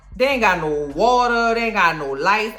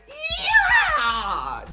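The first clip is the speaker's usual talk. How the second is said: high laughing scream of joy; shrill cheering shout; triumphant yell. Retold or imitated. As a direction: whoop with laughing delight. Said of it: "I was like".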